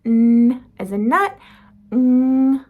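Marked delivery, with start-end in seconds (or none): 0.0-0.6 loud hummed "n"; nasal n. Shown as a demonstration. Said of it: "those are the nasal sounds". 1.9-2.6 loud "mm" hum as the "ng" in ring; nasal ng. Shown as a demonstration.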